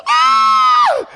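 piercing high pained scream. Retold or imitated. Pastor retelling the doctor yanking his toe, high scream. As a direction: shriek as the toe is yanked. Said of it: "And I screamed".

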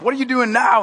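mock-angry devil losing patience; mad devil. Devil confronts Sven and Oli in hell, exasperated. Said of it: "devil's just madder and snot".

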